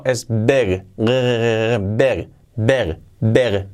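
big ending on a clear voiced G, "beg"; voiced final G. Shown as a demonstration. Teacher drilling students on big with a voiced G. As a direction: land the G in big fully voiced. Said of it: "Y la G".

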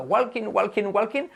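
"walking" with a sounded L; Spanish mispronunciation. Shown as a demonstration. Teacher mimicking lifelong classroom error, Spanish mispronunciation. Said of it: "Y toda la vida diciendo".

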